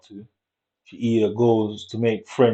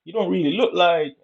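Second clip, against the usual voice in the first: raised-pitch nosy question; curious questioning; 8 semitones higher than usual. Retold or imitated. Asking the girl where she's really from, high and curious. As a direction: ask it lightly, fishing. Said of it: "You say".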